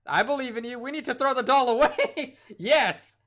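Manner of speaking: loud, high, laughing through the words; cracking up at the choice. Real, not acted.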